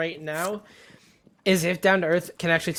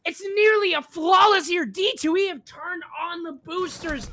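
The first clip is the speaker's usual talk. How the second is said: loud, higher, shouted play-by-play; excited casting; 8 semitones higher, 4 dB louder than usual. Acted. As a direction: call the round at full hype.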